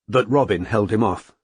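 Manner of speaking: ordinary delivery, nothing marked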